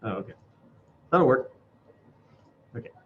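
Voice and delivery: usual speaking voice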